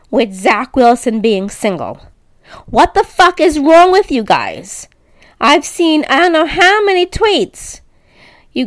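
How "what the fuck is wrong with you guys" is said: usual speaking voice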